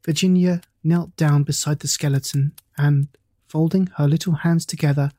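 plain reading voice

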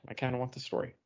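ordinary delivery

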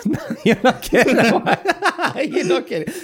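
high-pitched words breaking into laughter; real laughing fit. Real, not acted. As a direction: crack up while trying to speak. Cracks up at co-host's comeback.

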